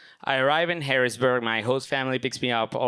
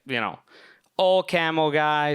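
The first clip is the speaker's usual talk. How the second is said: normal talking voice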